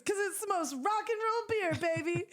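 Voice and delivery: a touch of a high, cocky friend voice; lightly imitating Vera. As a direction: sell the beer like it's rock and roll. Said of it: "And she goes".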